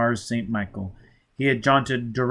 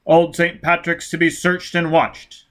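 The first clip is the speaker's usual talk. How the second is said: loud, higher announcer reading out orders; official broadcast voice; 5 semitones higher, 5 dB louder than usual. Acted. Police radio bulletin announcing the war crisis, loud and clipped. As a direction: bark out the emergency bulletin.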